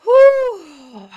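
high, loud release of breath; letting the emotion out in one big exhale; loud emotional whew. Real, not acted. Host blowing off emotion after a sad story, loud whew. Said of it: "I'm emotional over that one".

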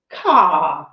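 a smug, mocking caw cry. A narrator's reading. Sheep smugly cawing at the hound. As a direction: caw to tease him again. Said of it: "the sheep said smugly".

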